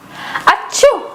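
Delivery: high mock "achoo"; storybook sneeze, pitched high; acted sneeze. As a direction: fake a sudden sneeze.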